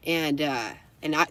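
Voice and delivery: ordinary delivery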